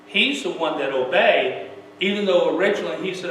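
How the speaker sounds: normal talking voice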